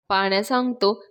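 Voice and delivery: usual speaking voice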